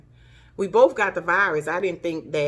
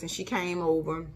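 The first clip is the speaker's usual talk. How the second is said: ordinary talk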